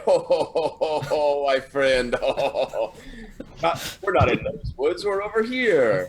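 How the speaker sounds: high hearty ho ho ho, then pleading; jolly friar laughing big; jolly booming laugh. Acted. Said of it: "He's like".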